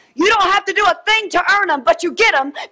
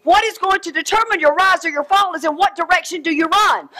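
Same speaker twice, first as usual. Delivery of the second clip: ordinary delivery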